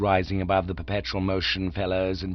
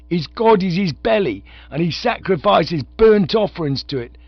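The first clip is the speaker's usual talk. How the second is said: loud, high soapbox jeer; ranting orator; 11 semitones higher, 7 dB louder than usual. A narrator's reading. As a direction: point him out to the mob. Orator continues the harangue.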